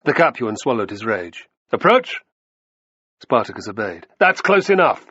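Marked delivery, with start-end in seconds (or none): 1.7-2.2 loud, high-pitched order; shouted command. A narrator's reading. Fortis orders Spartacus to come nearer, shouting. Captain commands the disarmed slave. 4.2-5.0 loud, high warning shout. A narrator's reading. Fortis stops Spartacus ten steps away, shouting. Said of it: "shouted Fortis".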